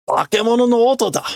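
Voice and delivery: man realising it's a monster, high and fast; character voice. Acted. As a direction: blurt out the fear. Voicing character.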